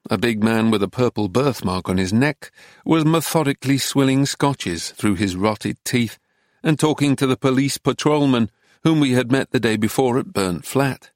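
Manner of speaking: usual speaking voice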